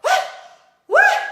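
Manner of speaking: very high, loud startled cry; reenacted panicked shriek; shocked yelp. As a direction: yelp it in disbelief. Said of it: "And we're like".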